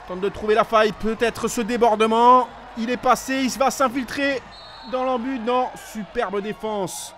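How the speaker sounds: rising, shouting play-by-play; excited sports commentary. Real, not acted. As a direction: call the near-try at full voice. French attack nearly scores, live call.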